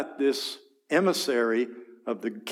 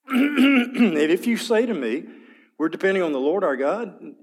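taunting shout; 6 semitones higher, 5 dB louder than usual. Acted. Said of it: "here's this taunting arrogance".

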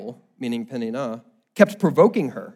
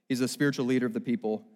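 ordinary talk, nothing marked

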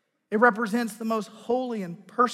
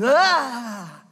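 sudden yelp of fright at a snake; startled cry; 4 semitones higher, 9 dB louder than usual. Shown as a demonstration. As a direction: shout out a gasp of fear. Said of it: "your involuntary audible response came out".

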